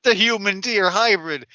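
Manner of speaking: mock proclamation. Podcaster mocking the prophecy of the chosen one, mock proclamation.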